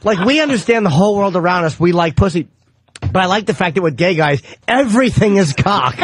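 normal talking voice

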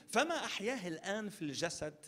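usual speaking voice

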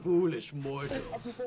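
slow villain drawl; dark lord. Pretend. Playing the villain snowman.

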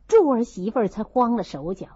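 ordinary delivery

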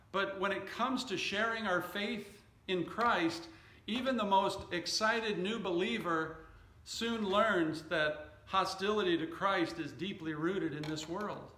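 ordinary talk

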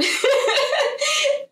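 a bright, high-pitched laugh; short amused giggle. Real, not acted. Laughs at Duffy's charm.

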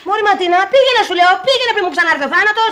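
high, loud yelling her away; angry shout. Acted. Eleni shouting at Matina to leave, angry.

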